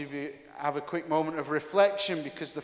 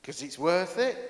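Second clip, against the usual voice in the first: normal talking voice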